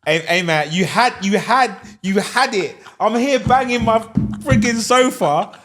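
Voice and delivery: loud, high-pitched mock scolding; hammed-up exasperation, words punched out; mock outrage. Podcast host teasing a coach who got talked down, mock outrage.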